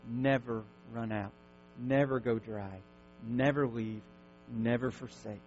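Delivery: low, soft, unhurried reassurance; calming guided pause. Acted. Pastor leading congregation into a deep breath, softly calming. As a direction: settle the room down gently.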